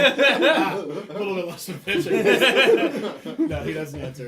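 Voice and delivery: loud words through big laughs; real laughing. Real, not acted. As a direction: barely get it out for laughing. Table bursts out laughing.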